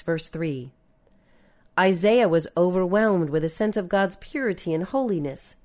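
ordinary talk

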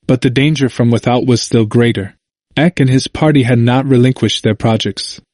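usual speaking voice

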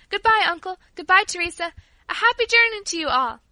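high, loud, cheery farewell call; calling out goodbye. A narrator's reading. Paula calling from the window to the departing family, high farewell cry. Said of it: "cried Paula".

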